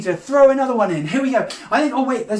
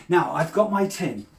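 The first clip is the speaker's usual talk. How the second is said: ordinary talk, nothing marked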